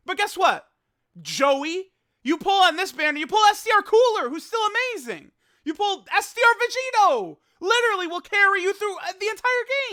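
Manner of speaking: high, loud yelling; hyped-up ranting. Acted. Telling Joey about his amazing pull, hyped-up ranting. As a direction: yell it like an outraged hype man.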